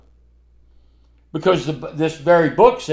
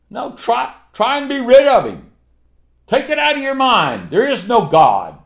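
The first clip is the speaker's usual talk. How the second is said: higher, urgent challenge; rising challenge; 7 semitones higher than usual. Real, not acted. Preacher daring the doubter, higher urgent challenge. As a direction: press the dare on them.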